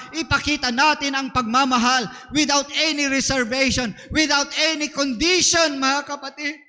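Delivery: loud, driving preacher's appeal; full-force preaching. Acted. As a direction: preach it out to the whole congregation.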